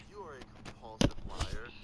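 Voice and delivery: yelling at her in front of the family; loud accusation. Retold or imitated. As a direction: blurt it out at full volume. Retold accusation, second take.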